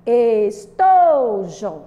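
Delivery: "Estojo" sung out very slowly, "to" long and loud; sing-song stress on TO. Shown as a demonstration. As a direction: chant "Estojo", holding the middle syllable. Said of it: "vamos falar assim, cantando".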